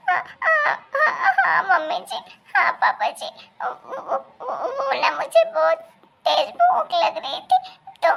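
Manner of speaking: high, fumbling, giggly excuses; a flustered bride laughing nervously through her excuses; nervous young daughter-in-law. Acted. Caught daughter-in-law stammering excuses to her in-laws, high and nervous.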